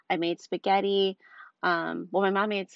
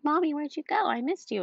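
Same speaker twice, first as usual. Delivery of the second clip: put-on high-pitched sweet little-kid voice; cutesy child impression, pitched up; imitating cute toddler; 8 semitones higher than usual. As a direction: play a small child who missed Mommy. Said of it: "she's being cute".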